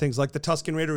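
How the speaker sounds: normal talking voice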